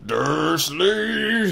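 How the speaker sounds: loud high booming shout. A narrator's reading. Hagrid booming at Uncle Vernon, loud. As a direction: boom the name. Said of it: "he boomed".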